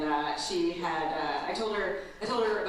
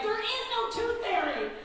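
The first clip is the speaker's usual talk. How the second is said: blunt mom; 13 semitones higher than usual. Retold or imitated. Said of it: "I was like".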